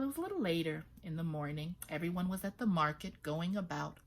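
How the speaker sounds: plain reading voice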